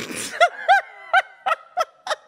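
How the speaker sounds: shrieking burst of laughter; high squealing laugh. Real, not acted.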